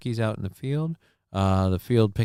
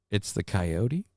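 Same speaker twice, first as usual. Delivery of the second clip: usual speaking voice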